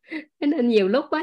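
ordinary delivery

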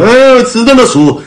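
a crying teen's choked high answer, imitated; mock crying kid. New kid crying as he answers the boss, high and tearful. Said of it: "哭了，说".

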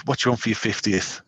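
normal talking voice

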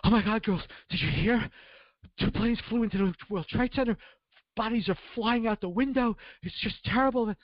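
put-on teacher breaking terrible news to the girls, high and alarmed; shocked teacher. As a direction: blurt it out like a teacher bursting into class. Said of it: "and she goes".

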